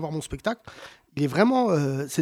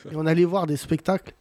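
normal talking voice

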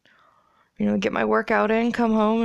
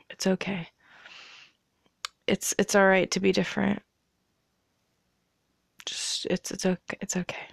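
soft reassuring; 5 dB quieter than usual. Pretend. Mock-comforting the psychopaths.

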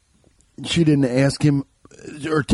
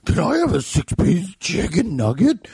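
pitched-up cartoon dog voice with a grunt; Tim the Toolman Taylor and Scooby-Doo; 6 semitones higher than usual. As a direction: order food like a goofy cartoon dog. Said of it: "like Tim the Toolman Taylor and Scooby-Doo".

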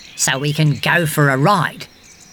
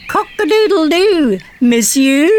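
high, crowing "Cock-a-doodle-doo, monsieur" in a mock French accent; playful French rooster crow; 7 semitones higher than usual. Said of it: "rooster crows with a French accent".

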